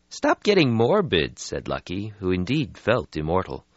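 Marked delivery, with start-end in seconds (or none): 0.1-1.4 brisk, a bit higher; young vampire voice. Acted. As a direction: brush off the gloom. Lucky voiced in story.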